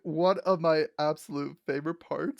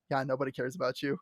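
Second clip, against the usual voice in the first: quieter, fast flat put-down; mock deadpan Sphinx; 4 semitones lower, 7 dB quieter, faster than usual. Sphinx dismissing her in the retelling, quiet deadpan. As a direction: toss it off coldly.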